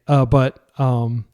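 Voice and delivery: usual speaking voice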